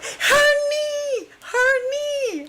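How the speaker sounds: high-pitched, drawn-out imitation of a panicked crowd; stretched, squealed mock outcry; mock yelling. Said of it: "Everyone starts yelling at Jason".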